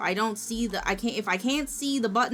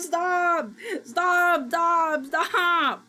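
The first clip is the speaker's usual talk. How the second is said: mock yell; 7 semitones higher, 5 dB louder, much slower than usual.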